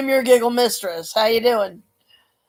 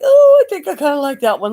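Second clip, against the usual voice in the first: playful self-quote; 7 semitones higher, 5 dB louder than usual. Retold or imitated. Quoting her own inner reaction.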